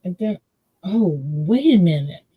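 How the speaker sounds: ordinary talk, nothing marked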